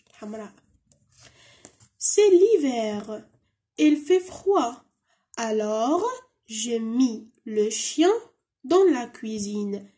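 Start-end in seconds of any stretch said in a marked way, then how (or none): 2.0-9.9 very slow, spaced-out French lines; teacher sounding out French word by word; slow model reading. Shown as a demonstration. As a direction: read each phrase slowly for the class. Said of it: "C'est l'hiver معناها".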